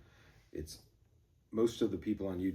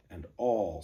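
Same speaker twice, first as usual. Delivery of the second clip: "all" hit hard and loud; stressed "all"; 6 dB louder than usual. Shown as a demonstration. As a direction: lean on the word "all". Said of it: "stressed beat of this syllable".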